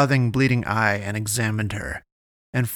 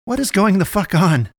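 much higher, loud demand; angry demand; 9 semitones higher, 5 dB louder than usual. Acted. Naomi demanding answers from the girl, loud. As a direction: fire the question at her. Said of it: "demanded of the girl".

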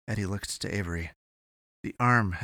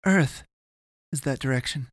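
higher-pitched, anxious explaining; panicked voice; 5 semitones higher, 3 dB louder than usual. A narrator's reading. Avery tells Eddie her fearful discovery, panicked. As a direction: start loud and alarmed, then ease slightly. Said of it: "she said in a panicked voice".

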